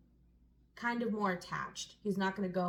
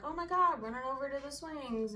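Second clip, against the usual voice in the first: put-on excited kid; 7 semitones higher than usual. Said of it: "you'd be like".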